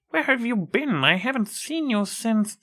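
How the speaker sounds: put-on an old teacher amazed to see a former pupil; surprised old teacher. Teacher presses Trixie.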